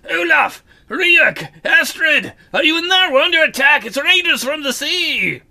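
high panicked shout; urgent shouting. Acted. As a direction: yell a warning through the door. Smith shouting warning at door.

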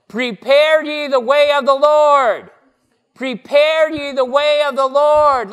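"Prepare ye the way of the Lord" shouted high and loud, each word called out; loud proclamation. Shown as a demonstration. Pastor marching with children down the aisle, loud prophetic call. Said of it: "see how much louder that is".

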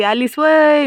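calling out from afar. Retold or imitated. Sister-in-law calls to her across the house, loud call. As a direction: shout the question over a distance. तिकडं आवाज येते.

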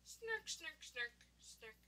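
very soft snorting "snark"; tiny, hushed sleep snorts; little snorts. Acted. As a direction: let the snarks fade and drop in pitch.

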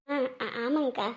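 low, soft, worn-out voice; subdued, throat-sore murmur; strained tired throat. Acted. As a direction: answer quietly as if the throat has caught. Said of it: "என் குரல் எல்லாம் ஒரு மாதிரியா இருக்கு".

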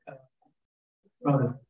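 ordinary talk, nothing marked

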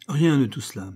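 ordinary delivery

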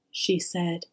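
ordinary delivery

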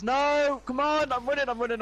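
high, loud in-game panic; panicked yelling. Real, not acted. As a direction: yell as you flee. Running away in game.

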